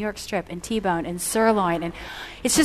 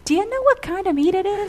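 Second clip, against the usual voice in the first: higher, pressing question; prying Edgar; 11 semitones higher, 5 dB louder than usual. Retold or imitated. Edgar on the phone, curious and higher. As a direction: Edgar pushes Beth about the meat, higher and pressing. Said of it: "And he's like".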